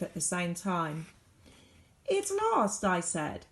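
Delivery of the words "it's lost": high, insistent plea. A narrator's reading. Child insisting to parents the thing is lost. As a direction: say it higher, pleading.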